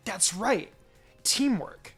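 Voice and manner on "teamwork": plain reading voice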